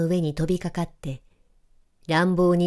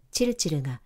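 ordinary delivery

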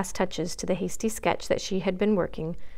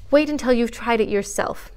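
usual speaking voice

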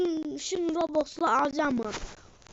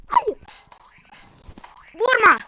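loud, much higher panicked cry; panicked plea; 7 semitones higher, 7 dB louder than usual. Real, not acted. Gamer begging an attacker, panicked. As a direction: beg them not to hit.

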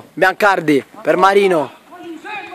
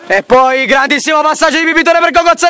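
excited commentary; 7 semitones higher, faster than usual. Real, not acted. Goal build-up in commentary.

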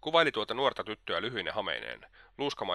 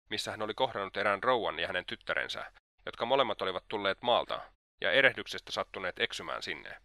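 ordinary delivery

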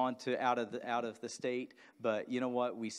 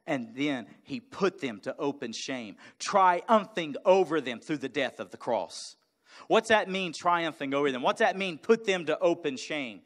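louder, higher building proclamation; rising preaching; 8 semitones higher, 12 dB louder than usual. Acted. Pastor explaining the cross to the church, building preaching. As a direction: build it up to a shout of victory.

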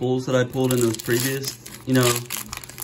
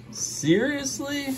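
high, slow Seriously; a drawn-out high Seriously; disbelief; 13 semitones higher, 4 dB quieter, much slower than usual. Real, not acted. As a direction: stretch out Seriously in shock. Disbelief at a pull.

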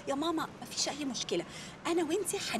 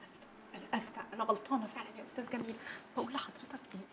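much softer, hurried whispered apology; hushed apology; 5 semitones lower, 12 dB quieter, faster than usual. Acted. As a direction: whisper your excuses nervously. Apologizing quietly to the boss.

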